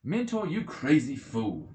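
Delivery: loud, slow, contemptuous shout; shouted insult. Acted. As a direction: yell him down as a crazy fool. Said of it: "he shouted at him".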